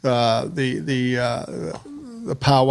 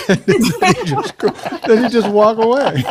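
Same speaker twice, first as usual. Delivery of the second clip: laughing; 8 semitones higher, 4 dB louder than usual. Real, not acted. Laughing at his friend's joke.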